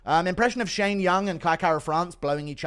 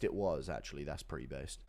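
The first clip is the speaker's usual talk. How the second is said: ordinary talk, nothing marked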